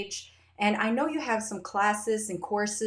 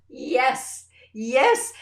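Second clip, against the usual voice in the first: louder, higher gleeful yes; delighted yes; 5 semitones higher, 8 dB louder than usual. Retold or imitated. Mother thrilled by daughter's handling, gleeful. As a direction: cheer it under your breath. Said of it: "I was just".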